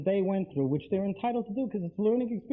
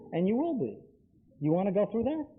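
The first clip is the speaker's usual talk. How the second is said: normal talking voice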